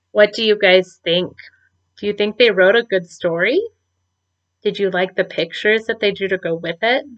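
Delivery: plain reading voice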